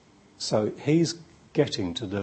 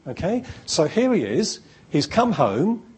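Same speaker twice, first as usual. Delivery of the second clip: ordinary talk, nothing marked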